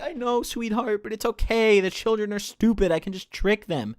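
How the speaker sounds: a hint of a smug father confiding; mock dad. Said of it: "He's like".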